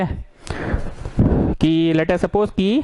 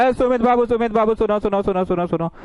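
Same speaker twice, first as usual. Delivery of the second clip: higher, sustained call with repeated words; 4 semitones higher than usual. Acted. Someone calling Sumit back to finish work, calling out. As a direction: hail someone who is walking off, in mild mock shouting. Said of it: "तो उधर से कोई चिल्लाएगा".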